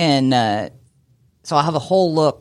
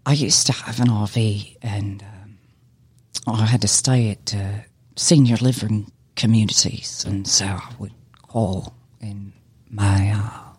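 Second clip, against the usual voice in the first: put-on little old British lady; 7 semitones lower than usual. Shown as a demonstration. Actor shows off an accent to the host, little old British lady voice. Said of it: "little old lady voice".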